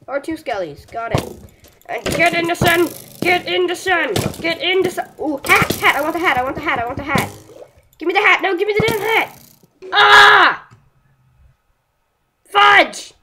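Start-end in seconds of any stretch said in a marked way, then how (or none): 1.9-5.1 high, loud yell at the mob. Real, not acted. Yelling at mob to burn. 6.0-9.4 rising from loud to louder and higher, grabbing for the hat; greedy yelling. Real, not acted. Desperate for dropped hat. 9.9-10.7 high, loud shocked scream. Real, not acted. Killed suddenly. 12.5-13.1 high, loud exasperated curse; frustrated outburst. Real, not acted. Frustrated after death.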